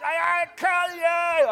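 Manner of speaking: very high, drawn-out mock yell; hammed-up shouting. Naked addict chasing the pastor, imitated screaming threat. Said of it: "he was shouting".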